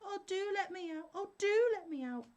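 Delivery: very high, pleading little spirit voice; trapped spirit begging the scholar to free him; piteous cry. A narrator's reading. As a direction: beg pitifully from inside the bottle. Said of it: "the spirit cried very piteously".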